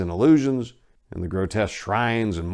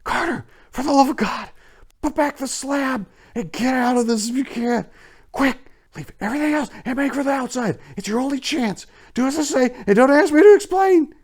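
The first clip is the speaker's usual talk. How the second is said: much higher, urgent frantic pleading; wild consternation; 6 dB louder than usual. A narrator's reading. Warren urging Carter to escape, wild frantic pleading. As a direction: push him to flee, fast and desperate. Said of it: "in a pitch of wilder consternation".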